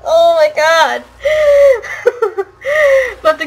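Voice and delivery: laughing. Real, not acted. Breaks into laughter.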